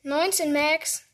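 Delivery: usual speaking voice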